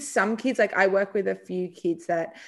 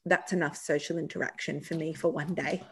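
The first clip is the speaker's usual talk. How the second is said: put-on a quiet lower, matter-of-fact kid voice; deadpan little boy; 3 semitones lower, 8 dB quieter than usual. The little boy who has had enough conversation, quiet and deadpan. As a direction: announce it like a tired little professor. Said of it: "he'll go".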